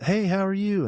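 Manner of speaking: high-pitched mock-friendly hello; retold friendly greeting. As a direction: greet them way up high, acting it out. Acting his friendly greeting to locals.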